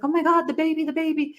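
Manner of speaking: put-on high panicked "the baby"; imitating a frightened landlord shouting; retold panicked cry. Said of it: "he's like".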